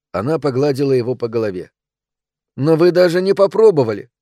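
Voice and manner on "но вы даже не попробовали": put-on high, loud child's protest; protesting little boy. A narrator's reading. Son reproaching his parents for not trying, indignant. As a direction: accuse them in a small boy's raised voice. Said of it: "сказал Сэм".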